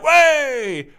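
a high, loud imitated cheer from the pub mates; mock cheer. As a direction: throw it up high like mates cheering a free round. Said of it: "they'd be like".

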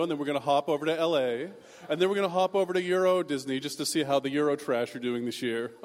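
loud, showy mock-snob chatter; boastful rich kids. Rich kids comparing Disney trips by the lockers, loudly boastful. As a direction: brag loudly like a spoiled rich kid.

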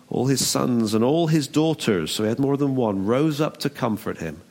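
normal talking voice

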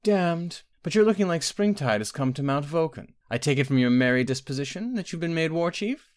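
high-voiced queen, teasing her visitor; wry queen.

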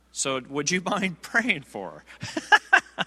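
slow, laughing through the question; laughing request. Retold or imitated. Elder asking the preacher to pray for the woman, laughing. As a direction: ask it while breaking into a laugh. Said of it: "he starts laughing".

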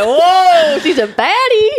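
high, loud, drawn-out wow; impressed. Retold or imitated. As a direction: gush with amazed admiration. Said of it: "I was like".

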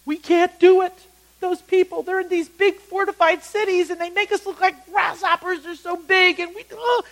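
high, loud mock panic; hammed-up high-pitched complaining, breaking off into a groan; negative naysayers. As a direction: wail it like defeated, overwhelmed scouts. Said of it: "They were a lot negative".

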